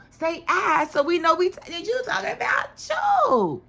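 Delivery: high, loud scolding building to a shout; exasperated outburst. Real, not acted. Viewer telling Bobby off for third-person talk, mounting exasperation.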